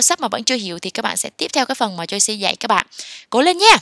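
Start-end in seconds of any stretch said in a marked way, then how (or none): 3.3-3.8 high, loud burst of encouragement; cheering on. Real, not acted. Teacher urging learners to keep trying, cheering.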